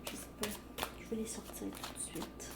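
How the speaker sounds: ordinary delivery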